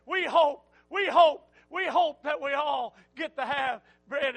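fiery preacher crescendo; preaching shout. Real, not acted. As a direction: lift it to the rafters. Preacher building to a climax.

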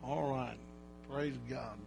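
ordinary talk

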